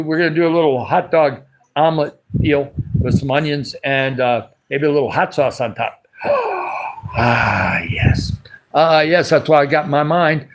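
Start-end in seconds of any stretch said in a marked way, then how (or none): 6.2-7.1 laughing gasp. Real, not acted. Laughing gasp after hot sauce.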